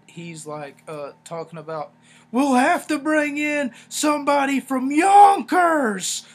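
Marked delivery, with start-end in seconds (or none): 2.3-6.3 very loud, high, slow yell; full-volume imitation of a drowned-out talker; shouting over noise. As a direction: bellow each word to be heard over a blaring trumpet. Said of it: "trumpet that keeps getting louder and louder".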